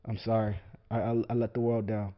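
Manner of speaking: soft, sheepish mock apology. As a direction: mumble it like a beaten fighter saying sorry. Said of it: "get up and be like".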